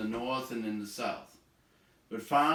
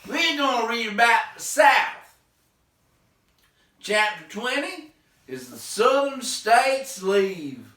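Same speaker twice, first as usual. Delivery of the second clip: mock Southern drawl, much higher and louder, slow; hammed-up Southern American accent greeting the class; bad Southern accent; 14 semitones higher, 15 dB louder than usual. Teacher greets class in a bad Southern accent. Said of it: "sorry for the bad Southern accent".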